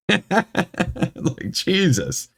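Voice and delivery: high, laugh-filled exclamation; laughing disbelief. Real, not acted. Reader laughs at the absurd review, incredulous. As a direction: let a laugh carry the voice up.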